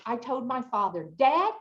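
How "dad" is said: angry. Retold or imitated. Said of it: "in a very angry voice".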